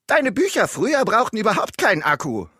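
ärgerlich, höher und lauter; verärgerte Ehefrau. Acted. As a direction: gereizt dazwischenfahren. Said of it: "meinte die Frau ärgerlich". (ärgerliche Ehefrau am Kaffeetisch)

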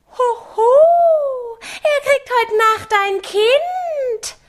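very high, slow mocking howl; howling harpies. A narrator's reading. Harpies jeer at Mattes in a very high slow howl. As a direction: shriek and jeer like wild harpies. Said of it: "heulten die Wildruden".

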